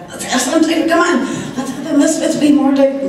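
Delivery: high-pitched mock coaxing; put-on pleading, cut off mid-word; pleading mimicry. Mimicking a pushy plea, cut off.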